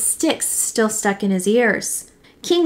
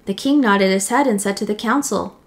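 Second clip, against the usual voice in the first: ordinary delivery, nothing marked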